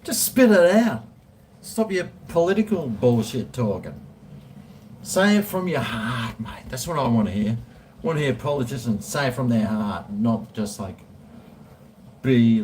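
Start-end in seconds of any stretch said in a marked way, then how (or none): 0.0-6.7 raised voice urging him to get on with it; exasperated heckle. Acted. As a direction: shout it like a fed-up voter. Heckling the slow-talking politician.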